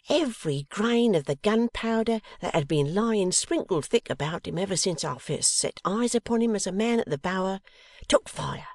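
animated storyteller in character; Mrs Boffin, storytelling. Acted. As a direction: relate it with fond, earnest feeling. Mrs Boffin tells her story.

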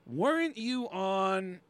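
slow, drawn-out questioning with long pauses; imitating a stranger placing a face; hesitant recognition. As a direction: stretch out the question as it dawns on you. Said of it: "who stopped me and said".

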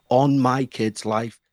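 put-on "On my kid's life" slow, each word hammered separately. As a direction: pound out every word of "On my kid's life" slowly. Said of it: "thumping the steering wheel with each word".

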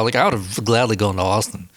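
ordinary talk, nothing marked

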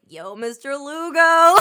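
slow swaggering greeting. Retold or imitated. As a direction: play it smooth and tipsy. Drunk greeting to hot teacher.

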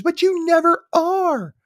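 high, drawn-out mock complaint; mock exasperated retort. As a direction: act out a wife's slow, high-pitched comeback. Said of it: "Patty's chewing out Graham".

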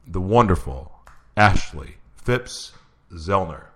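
slow, drawn-out host intro; announcer voice. Acted. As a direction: announce the guest grandly.

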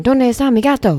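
¿Dónde está mi gato? sung high and loud with Italian lilt; Italian-sounding Spanish. Shown as a demonstration. Asked to say Spanish, she sounds Italian. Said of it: "That sounds Italian".

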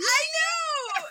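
very high, loud agreement; excited squeal. Real, not acted. Host agrees the fake mustaches are back, excited squeal. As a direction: squeal back in shared delight.

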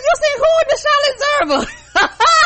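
very high, loud laughing disbelief; mock disbelief. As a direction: ask back, amazed. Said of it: "I said".

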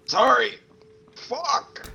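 high strained cry of pain; pained outburst. Real, not acted. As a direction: yelp through sudden pain. Psychic damage pain reaction.

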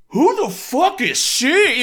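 loud, high, slow outrage; indignant teen. Retold or imitated. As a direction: blurt out indignant disbelief. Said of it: "I was like".

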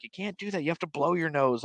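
high-pitched, soft mom voice; lightly mocked motherly telling-off; retold scolding mom. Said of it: "my mom's like".

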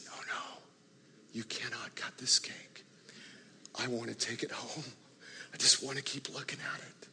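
soft, whispered, barely voiced; tearful whisper. [name] begs her friends not to cut the cake, tearful whisper. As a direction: hold back the tears, whisper it. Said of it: "begins to weep".

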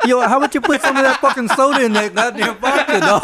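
loud, high protest through laughter; laughing mock protest. Mixed drink is all vodka, so he protests loudly while laughing. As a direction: laugh and shout it at the friend. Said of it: "I was like".

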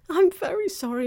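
high, loud apology; pleading child. A narrator's reading. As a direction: plead anxiously as a little girl.

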